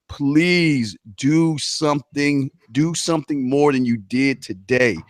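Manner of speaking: slow, drawn-out motivational plea; earnest exhortation. Real, not acted. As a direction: urge the crowd word by word. Closing motivational address to listeners.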